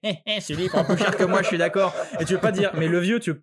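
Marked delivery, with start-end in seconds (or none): 0.0-1.9 put-on gloating old man. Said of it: "Et lui il fait".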